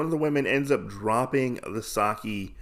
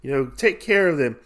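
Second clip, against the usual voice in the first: higher, brisk retold order; bossy scolding; 7 semitones higher, faster than usual. Inn manager sends worker to customers, bossy. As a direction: order her about in a raised pitch.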